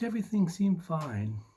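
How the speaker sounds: ordinary delivery, nothing marked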